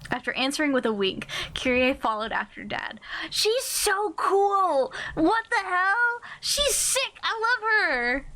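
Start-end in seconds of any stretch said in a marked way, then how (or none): 3.3-4.9 high, slow gushing squeal; excited squeal. Real, not acted. Fangirling over Kyrie. 5.2-7.1 high-pitched fangirl shriek; excited squeal. Real, not acted. Fangirling over Kyrie. 7.2-8.2 high, slow fangirl gush; excited gushing. Real, not acted. Fangirling over Kyrie.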